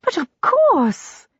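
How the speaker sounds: high, slow eager exclamation; exclaiming. Acted. Young wife eagerly agreeing with her guest, high exclamation. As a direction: throw it up high in delighted agreement. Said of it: "exclaimed Sir Foley's wife".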